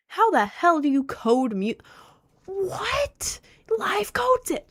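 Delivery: high, squeaky incredulous outburst; amazed disbelief. Real, not acted. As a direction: let astonishment send the voice way up. Amazed at collaborator coding music live.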